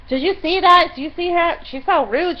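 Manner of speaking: loud, high imitation of outrage; mock indignation. Woman turning around to strangers on the bus, mock indignant. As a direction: mimic someone rallying bystanders, loud and high.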